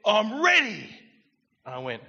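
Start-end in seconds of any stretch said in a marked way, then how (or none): 0.0-0.9 loud, high excited announcement; retold pumped-up cry; pumped, imitated. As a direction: burst out with it like she's leapt from her seat. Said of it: "She was so pumped".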